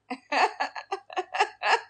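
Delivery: high rattling giggle; real amused chuckle; laughing. Real, not acted. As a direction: laugh it off in quick bursts. Laughs about differing accents.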